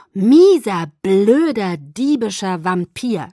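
angry, loud cursing that eases off; cursing someone word by word, punched hard at the start; scolding. A narrator's reading. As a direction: spit out each insult slowly. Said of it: "schimpfte ich".

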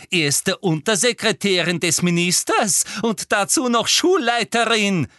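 high, loud hysterical tirade; frenzied, triumphant rant; shrieking accusation. A narrator's reading. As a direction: screech it as if unmasking a plot. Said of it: "kreischte Professor Umbridge".

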